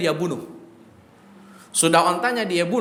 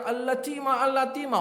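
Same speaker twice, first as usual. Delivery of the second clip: high, soft mock calling; imitated alarm cry; 5 semitones higher, 5 dB quieter than usual. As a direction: mimic a distant cry for help, pitched up. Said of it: "Dhamdham teriak-teriak".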